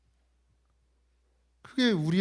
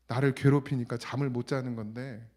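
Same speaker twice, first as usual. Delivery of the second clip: normal talking voice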